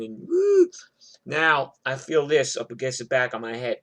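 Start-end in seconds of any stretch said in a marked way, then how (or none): 0.3-0.7 a short shrill whimpering wail; mock high wail. Jersey guy crying with shotguns on him, a high wail. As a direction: whimper like a man crying in terror. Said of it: "Mister New Jersey's, like, crying".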